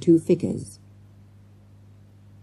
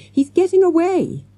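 high urgent cry; shouted; 12 semitones higher, 3 dB louder than usual. A narrator's reading. Boy seeing the gunman escape, urgent shout. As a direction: call out the alarm in a raised pitch. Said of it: "shouted Morgan".

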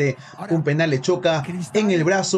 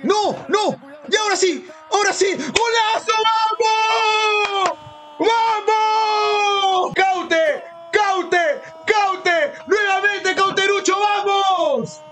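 breakneck goal commentary; 12 semitones higher, much slower than usual. Real, not acted. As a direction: scream the goal call. Goal celebration, shouting commentary.

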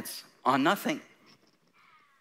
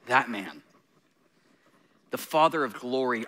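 ordinary talk, nothing marked

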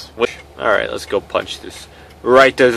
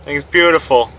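usual speaking voice

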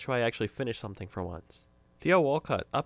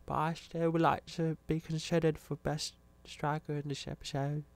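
a light, higher put-on British accent, jokey; playing the striker in a cheeky British accent; mock British accent; 4 semitones higher than usual. Commentator voicing his German striker with a British accent. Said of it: "I'm British".

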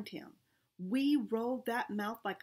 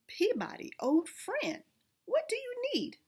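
much higher, delighted greeting; light up at seeing an old friend; excited exclaim; 13 semitones higher than usual. A narrator's reading. Da Vinci greeting his old friend Peabody, high and excited. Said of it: "Mister da Vinci exclaimed".

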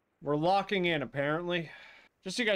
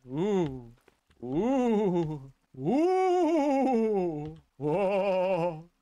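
rising "ooh"s; 4 dB louder, much slower than usual. Real, not acted. Sees something, wordless cries.

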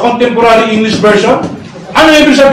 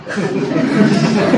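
breathy laugh; 3 semitones lower, 8 dB quieter than usual. Real, not acted. Laughs at his own shouting.

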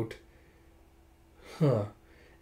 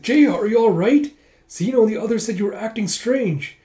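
put-on high, loud, alarmed girl; urgent acted concern for a friend; worried calling out; 9 semitones higher, 8 dB louder than usual. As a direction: call out anxiously, raised pitch. Worried Yona calls to collapsing friend.

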